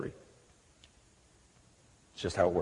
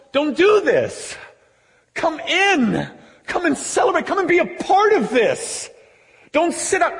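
high, urgent plea; impassioned pleading; 8 semitones higher than usual. Acted. Father outside the party begging his older son, high pleading. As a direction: implore him, pitch lifted right up. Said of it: "he pleads with him".

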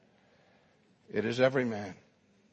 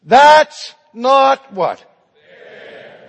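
loud, high, drawn-out outcry; crying out in protest; 9 semitones higher, 13 dB louder, much slower than usual. Acted. Students protesting their grades with one voice, crying out. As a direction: belt out the protest slowly. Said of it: "they cried out in protest".

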